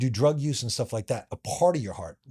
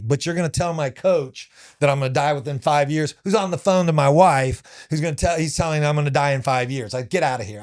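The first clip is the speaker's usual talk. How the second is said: raised, heated rant at the nurse; angry rant retold to the interviewer, raised and heated; livid; 4 semitones higher, 6 dB louder than usual. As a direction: tell her off, louder and louder, furious. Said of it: "like, so livid".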